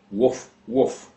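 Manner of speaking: two short mock barks, woof woof; dog bark imitation. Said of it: "and barks again".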